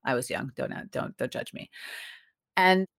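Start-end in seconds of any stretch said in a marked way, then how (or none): 0.6-1.7 embarrassed whisper; whispered plea. Real, not acted. Guest whispering to the hosts about her cheesy stage name. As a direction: hush it out, half joking, half shy.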